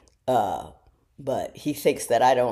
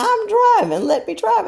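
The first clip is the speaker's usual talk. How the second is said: higher, louder insistent son; son snapping; 8 dB louder than usual. Retold or imitated. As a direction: push back hard, higher and louder. Son snaps at nagging mother.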